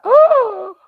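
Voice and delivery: high, loud "oh"; sudden fright; startled. Retold or imitated. Girl seeing the snake, startled loud. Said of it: "I was like".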